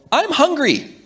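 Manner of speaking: put-on high little girl whine; high child's voice. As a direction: play the small daughter asking. Father voicing daughter's complaint.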